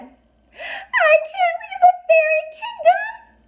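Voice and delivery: very high, slow, wailing fairy sob; tiny fairy wailing at losing her home; crying. A narrator's reading. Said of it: "Trix cried".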